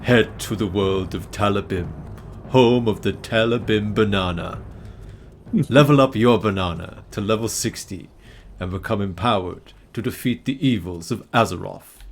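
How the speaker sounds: hammed-up game expansion trailer voice; mock trailer announcer. Streamer teasing a banana expansion, mock trailer voice. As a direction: announce it like an epic trailer, tongue in cheek.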